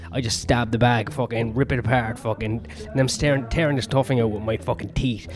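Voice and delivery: normal talking voice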